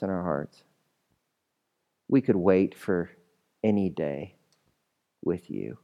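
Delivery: low, subdued praying with long pauses; hushed, reverent closing prayer; pastoral prayer. Real, not acted. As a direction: pray quietly over the congregation. Leads the congregation in prayer.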